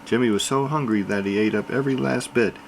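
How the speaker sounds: ordinary delivery, nothing marked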